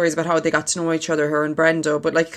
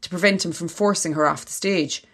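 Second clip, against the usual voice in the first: reading aloud. Acted. Reads an article aloud.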